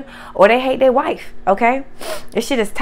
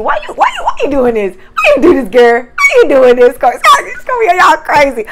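real thrill at the cards, calling out; excited disbelief; 3 semitones higher, 8 dB louder than usual. Real, not acted. Reacting to cards drawn.

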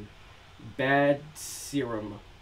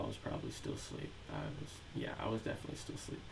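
whispering; 3 semitones lower, 12 dB quieter, much faster than usual. Real, not acted. Sleepy aside to stream chat.

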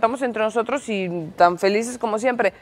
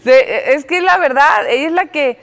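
talking through laughter, higher and louder; amused, laughing words; 5 semitones higher, 5 dB louder than usual. Real, not acted. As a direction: still laughing as you speak.

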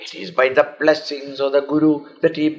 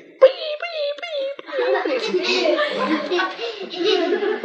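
squeaky high whimpers, fading softer; high dog whimpering; 5 dB quieter, much slower than usual. Acted. The frightened little dog whimpers at the master, high and squeaky.

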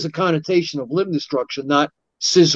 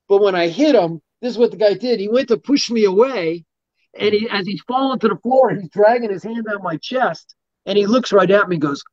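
usual speaking voice

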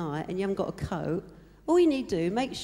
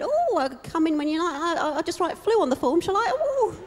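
higher, flustered stammering voice; flustered boss; 8 semitones higher than usual. Retold or imitated. Flustered boss stammering at a sick caller. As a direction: play the rattled boss on the phone, rising higher. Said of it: "this flustered person".

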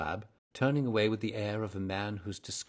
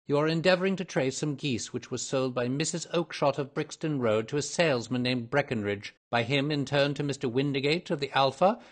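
raised, emphatic detective recital; all-knowing Holmes; 6 semitones higher, 7 dB louder than usual. A narrator's reading. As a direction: lay out the whole chain confidently. Holmes lists the facts.

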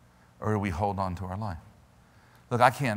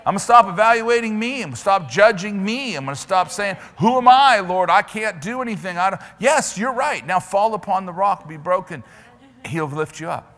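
loud, high preacher's cry, racing ahead; preaching; 8 semitones higher, 8 dB louder than usual. Acted. Preacher urging the congregation to stand, loud and fast. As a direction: drive the scripture home hard. Voicing a believer's prayer, preaching.

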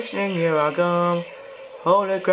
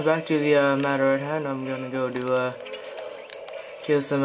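ordinary talk

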